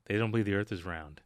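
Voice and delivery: usual speaking voice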